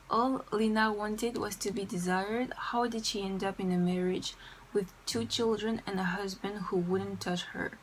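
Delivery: deliberate English, French accent throughout. Real, not acted. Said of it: "je déteste mon accent anglais".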